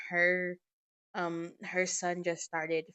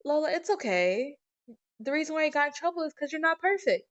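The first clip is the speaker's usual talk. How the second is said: put-on higher, childlike and sweet-innocent; little sister's voice; 9 semitones higher, 6 dB louder than usual. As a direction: play the little girl consoling her sister, high. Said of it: "Nova, and goes".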